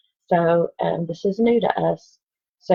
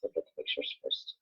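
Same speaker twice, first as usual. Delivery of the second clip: whispered aside to the class; hushed whisper reminder; 16 dB quieter than usual. Real, not acted. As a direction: breathe it out under the reading. Teacher aside while showing book.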